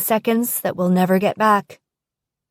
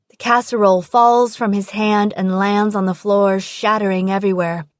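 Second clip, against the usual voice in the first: ordinary delivery, nothing marked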